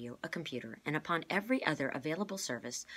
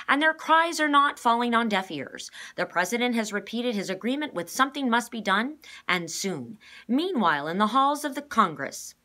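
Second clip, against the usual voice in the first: news reporter; 8 semitones higher, 12 dB louder than usual. A narrator's reading. The reporter speaks to the camera, high and loud. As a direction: announce it like a TV reporter. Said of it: "said the reporter".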